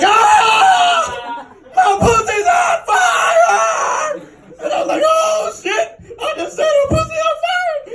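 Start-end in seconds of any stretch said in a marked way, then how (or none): 0.0-4.2 very high, drawn-out wail; screaming in pain. Retold or imitated. Retold scream of burning pain. 5.0-7.8 high-pitched panicked cry; frantic. Retold or imitated.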